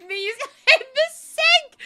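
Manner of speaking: high laughing squeal, slowly. Real, not acted. Laughing about making potions.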